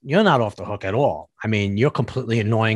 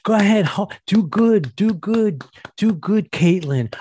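higher fake-encouraging cheers; mock cheering from the back; 9 semitones higher than usual. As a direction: cheer them on without meaning it. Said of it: "they're like".

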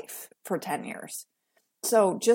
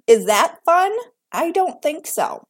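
emphatic rhetorical question; 9 semitones higher, 8 dB louder than usual. Real, not acted. Rhetorical challenge.

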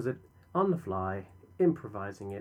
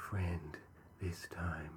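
softer, hushed whisper; 6 semitones lower, 9 dB quieter, slower than usual. Acted. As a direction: whisper the last words. Whispered ending of intro.

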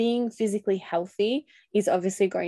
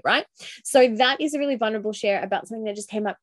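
normal talking voice

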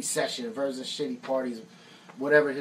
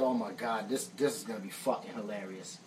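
muttered aside; 7 semitones lower, 9 dB quieter than usual. Retold or imitated. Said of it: "I said".

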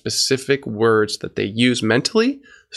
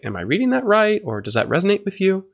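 gentle check-in, "Am I reading that right" lifted much higher; higher-pitched tentative questions, asking to confirm; questioning rise; 7 semitones higher than usual. Shown as a demonstration. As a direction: lift "right" and "resonate with you" as questions. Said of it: "put a question on the end of it".

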